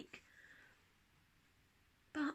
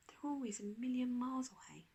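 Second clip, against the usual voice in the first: glum whisper; 3 semitones higher, 5 dB quieter than usual. A narrator's reading. Daisy glum, to the rhino.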